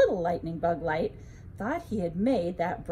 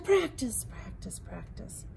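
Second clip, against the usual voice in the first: whispered echo; 4 dB quieter than usual. A narrator's reading. Cave echoes mother's words.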